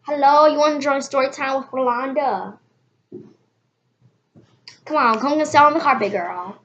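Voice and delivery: loud, high, sassy story-time hostess; bossy teacher calling kids to the carpet; hammed-up storytime lady. Comic teacher persona calling kid over.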